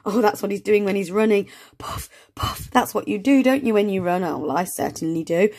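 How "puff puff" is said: breathy panting puff puff; out of breath puffing. Shown as a demonstration. Teacher showing how runners breathe, breathless puffing. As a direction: puff it out like you've been running. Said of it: "it makes you out of breath".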